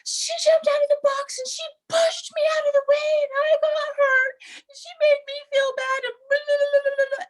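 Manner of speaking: put-on very high, whiny kid tattling; mock a crying kid's complaint; whiny child. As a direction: niece tattling to her mother, high whiny sob. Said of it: "my niece just proceeded to say".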